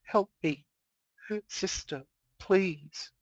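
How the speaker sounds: broken, begging plea; cracking plea. Acted. Mare begging her sister for help, crying. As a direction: beg her sister through tears. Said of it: "voice cracking ... little more than a whisper".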